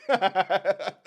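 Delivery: high, loud burst of laughter; big delighted cackle. Real, not acted. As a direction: crack up loudly. Amused reaction to the video.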